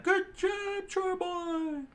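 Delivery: very high screeched praise; screamed cheer. A narrator's reading. Restaurant crew cheers the chore boy, screaming high. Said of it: "the Baratie crew screamed out".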